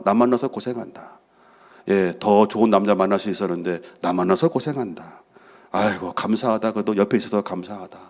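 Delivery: soft, low, compassionate sighing to himself; tender retold musing. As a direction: murmur grateful pity for your wife, low. Voices his tender feelings in prayer.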